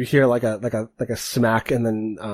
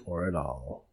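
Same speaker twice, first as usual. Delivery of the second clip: much softer, lower, mumbled under the breath; grudging mumble; 7 semitones lower, 13 dB quieter than usual. Acted. Ferocious echoes Defiant reluctantly in a soft low mumble. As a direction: repeat it grudgingly, barely audible. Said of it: "he says".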